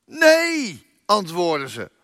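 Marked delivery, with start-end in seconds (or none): 0.0-0.9 calling back. A narrator's reading. Disciples shouting back from boat.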